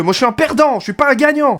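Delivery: high, impassioned declaration; proclamation exaltée de perdant. Real, not acted. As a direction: declare defeat as a proud battle cry. Proclame qu'il veut perdre. (proclamation exaltée, voix haut perchée)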